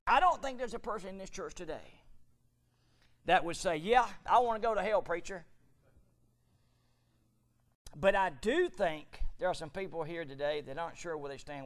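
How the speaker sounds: high, loud fire-and-brimstone preaching; full-force preaching. Acted. Preacher thundering Paul's warning at the church, loud and high. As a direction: thunder the scripture at the congregation.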